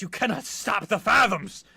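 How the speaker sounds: loud, defiant villain's proclamation. Acted. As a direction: declaim it like a fanatic throwing down a challenge. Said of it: "he leans back and he goes".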